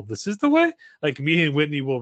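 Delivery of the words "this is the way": much higher, louder quoted line; movie quote. Retold or imitated. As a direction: pitch it up like a catchphrase. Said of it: "Megan with the whole".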